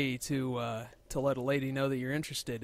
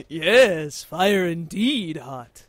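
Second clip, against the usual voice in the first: mock caveman; 3 semitones higher, 9 dB louder, much slower than usual. Echoes caveman joke.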